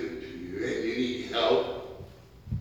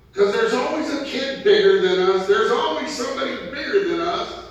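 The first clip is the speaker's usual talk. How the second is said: loud, rising preacher's call; fiery preaching; 11 semitones higher, 6 dB louder than usual. Acted. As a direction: preach it hard to the congregation. Preaching at full force.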